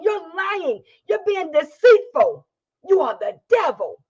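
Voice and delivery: building, accusing preaching. Acted. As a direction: hammer it home from the pulpit. Preaching at full force.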